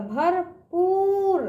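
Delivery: भरपूर with पूर stretched very long; drawn-out long ū. Shown as a demonstration. Teacher showing children the long vowel, भरपूर with drawn-out ū. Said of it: "इसका उच्चारण दूर तक जाएगा".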